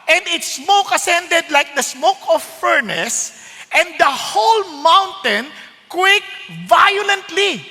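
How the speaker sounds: slow, rising dramatic reading; dramatic scripture reading. Acted. Preacher reading of the quaking mountain, slow and dramatic. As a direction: make the mountain shake with your voice. Reads verse slowly, building.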